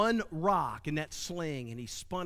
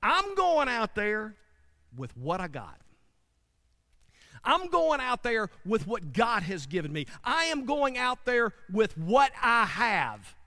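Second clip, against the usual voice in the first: David's rising declaration; 4 semitones higher, 5 dB louder than usual. Acted. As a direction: declare it like a shepherd boy who won't back down. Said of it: "He says".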